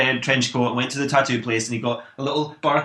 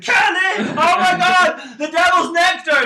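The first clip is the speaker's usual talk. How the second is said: high, loud, slow shriek; mother imitating the father's meltdown; mock hysterical father; 5 dB louder, slower than usual. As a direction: ham up the father's panic. Mother mimicking father's outburst.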